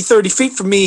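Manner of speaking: normal talking voice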